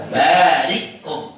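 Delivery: "Barikum" slow, long "baa" and stopped "ri"; hamzah sukun. Shown as a demonstration. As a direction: halt sharply after "ri" in "Barikum". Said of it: "Jadi bacanya apa?". ("Barikum" pelan, hamzah mati setelah ri)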